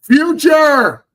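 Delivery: very high, loud falsetto yell; mock calling out. Mother calling her child to pass on a play, mock high yell. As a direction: holler for the kid in a hammed-up mother's shriek. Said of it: "And she's like".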